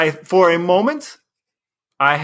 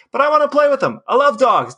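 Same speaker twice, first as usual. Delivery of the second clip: excited; 9 semitones higher than usual. Real, not acted. Gushing about dogs.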